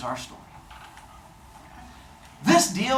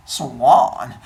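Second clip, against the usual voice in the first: low, loud, drawn-out swan call; suave swan; 4 semitones lower, 5 dB louder than usual. Retold or imitated. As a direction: honk it low and smooth. Swan sidles up to Leto.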